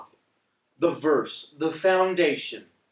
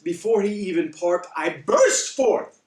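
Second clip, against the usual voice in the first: normal talking voice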